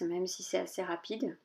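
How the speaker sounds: ordinary talk, nothing marked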